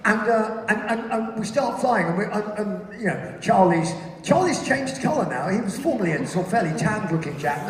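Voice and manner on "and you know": ordinary talk, nothing marked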